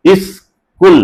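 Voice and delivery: "ইস্কুল" as "is", gap, "kul"; syllable split. Shown as a demonstration. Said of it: "এটা উচ্চারণ হচ্ছে ইস".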